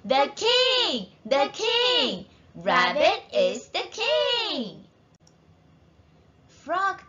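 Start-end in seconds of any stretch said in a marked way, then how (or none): 0.0-4.8 high, cheering shouts for a king; crowd shout. A narrator's reading. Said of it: "Everyone shouts".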